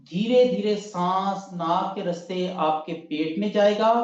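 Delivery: ordinary talk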